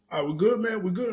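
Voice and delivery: high-pitched eager agreement; mock placating. Challenger offering a handshake to make peace, placating.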